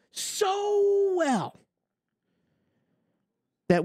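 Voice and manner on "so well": high, whining mock praise of so well; sarcastic wail. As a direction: stretch out so well in a high, crying whine.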